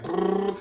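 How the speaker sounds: low, quiet lip-trill motor rumble; car engine noise. Retold or imitated. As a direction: imitate a car driving along with a soft brrr. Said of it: "that's the sound of our car".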